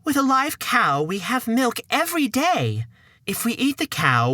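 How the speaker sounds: the worried mum again; high-pitched mother. Acted.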